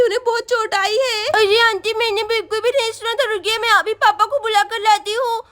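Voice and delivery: normal talking voice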